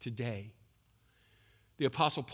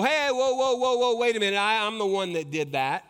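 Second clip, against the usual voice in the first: mock self-promotion; 6 semitones higher, 7 dB louder than usual.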